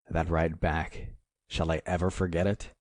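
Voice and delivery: ordinary delivery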